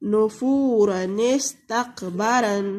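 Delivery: "नुफूरन इस्तकबरन" drawn out and joined; slow Quranic recitation. Shown as a demonstration. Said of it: "तो हम कैसे पढ़ेंगे".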